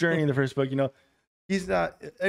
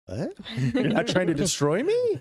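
higher, slower, put-on astonished voice; mock disbelief; 6 semitones higher, 4 dB louder than usual. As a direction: act stunned they are friendly.

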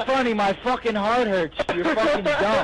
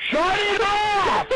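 laughing shout; 7 semitones higher, slower than usual. Real, not acted. Laughing fit, begging friend stop.

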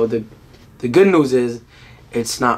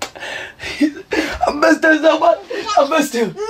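much higher, slow, laughing and crying; overwhelmed with joy; laughing through tears; 14 semitones higher, much slower than usual. Real, not acted. Father hugging his son after being away, laughing while crying.

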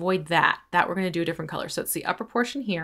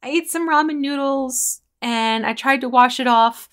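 higher, louder imitation of a small child; mock small girl's excuse; little girl voice; 7 semitones higher, 7 dB louder than usual. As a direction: play the innocent little kid explaining herself. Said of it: "And the little girl says".

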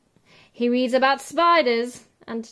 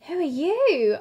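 higher startled question; startled girl; 6 semitones higher, 4 dB louder than usual. Acted. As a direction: ask it jumping up startled. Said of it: "asks the girl, jumping up".

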